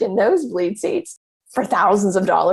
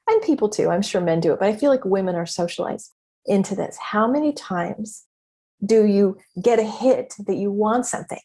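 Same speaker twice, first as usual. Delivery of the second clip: ordinary delivery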